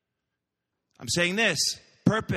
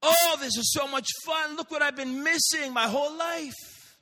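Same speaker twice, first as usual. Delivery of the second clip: delighted; 9 semitones higher than usual. Acted. Girl delighting in new life.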